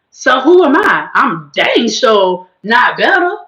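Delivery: ordinary talk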